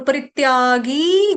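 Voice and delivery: परित्यागी with गी stretched out; long gi. Shown as a demonstration. Teacher showing students to lengthen the final गी. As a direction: draw out the गी in परित्यागी. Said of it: "गी को यहाँ पर थोड़ा सा खींचना है".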